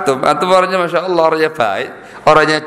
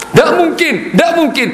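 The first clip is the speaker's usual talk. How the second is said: emphatic shout; 12 semitones higher than usual. Real, not acted. Preacher rejecting slander.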